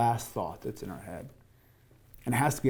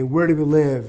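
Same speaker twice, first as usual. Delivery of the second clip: louder coach's chant call; imitated yell to a circle of runners; retold team yell; 2 semitones higher, 8 dB louder than usual. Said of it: "but I'd yell".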